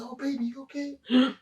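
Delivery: high-pitched imitated fussing; concerned wife. Wife running over to the tased man, mock concern. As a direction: coo it anxiously over someone hurt.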